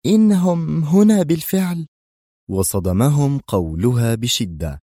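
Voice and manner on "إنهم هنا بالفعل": high, louder alarmed announcement; urgent warning. A narrator's reading. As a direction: warn the team of danger. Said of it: "مستطردة".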